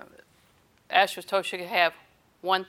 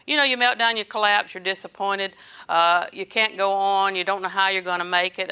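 ordinary talk

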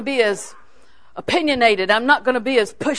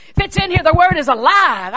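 high, loud proclamation; fervent preaching; 7 semitones higher, 7 dB louder than usual. Real, not acted. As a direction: proclaim it at full force, stretching the key words. Preacher rising in fervour.

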